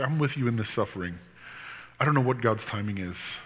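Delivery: soft, quiet words of comfort; gentle comfort. Shown as a demonstration. Friend comforts a sufferer quietly and gently. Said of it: "draw out their heart and say".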